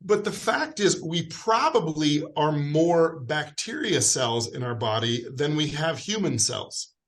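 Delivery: ordinary talk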